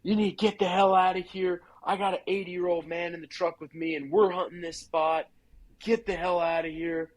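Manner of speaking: a territorial hunter yelling at an intruder; retold screaming. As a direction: tell him to get out, yelling. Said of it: "just starts screaming in my face".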